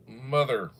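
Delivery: ordinary talk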